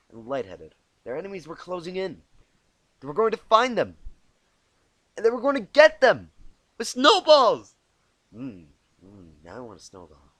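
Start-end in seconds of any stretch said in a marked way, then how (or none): 3.0-7.8 much higher, much louder mock-dramatic build; rising dramatic panic. A narrator's reading. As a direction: pump up the tension to a peak.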